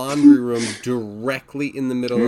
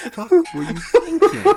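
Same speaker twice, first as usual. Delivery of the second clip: laughing; 4 dB louder than usual. Real, not acted. Laughing disbelief at partner.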